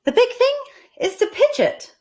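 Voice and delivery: high bright request; Amelia Bedelia voiced. Amelia Bedelia asking the boys for help, high. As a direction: ask brightly. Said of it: "said Amelia Bedelia".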